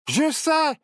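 high excited burst; eureka. A narrator's reading. Barnabé finding the idea, high excited cry. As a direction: shout it as the idea hits.

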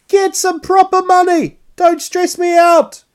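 high loud comic yell; mock outburst. Telling the old lady at the checkout off, high mock yell. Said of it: "förlåt".

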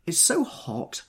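high-pitched complaint; complaining. A narrator's reading. Daisy to the others about the heat, complaining. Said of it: "she complained".

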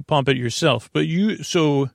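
ordinary talk, nothing marked

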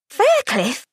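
sudden startled blurt; blurted in disbelief. A narrator's reading. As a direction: blurt it out in shock. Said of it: "she blurted in disbelief".

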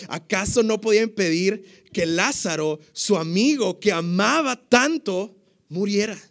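milk the added line about his beloved friend; preacher's own heightened version of the onlookers' question; dramatized version. Acted. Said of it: "le voy a agregar aquí algo extra, versión [name] habla hoy".

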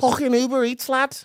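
high, squeaky mock Scouse; Scouse accent. Shown as a demonstration. Comedian showing co-host his squeaky Scouse. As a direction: put on a squeaky Liverpool accent. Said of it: "That's, that's my scouse".

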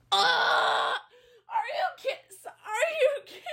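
high, drawn-out squeal of disbelief through laughter; helplessly laughing, very high-pitched outrage. Real, not acted. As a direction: shriek it incredulously while cracking up. Laughing disbelief at a TikTok quote.

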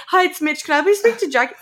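high, put-on telephone politeness; polite child caller. Rehearsed call to a friend's parent, high polite voice.

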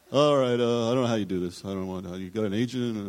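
a touch of brusque Larry David; lightly voiced Larry, gruff and hurried; Larry David asking about an agent, lightly done; Larry David impression. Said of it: "It's, uh, Larry David".

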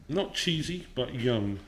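normal talking voice

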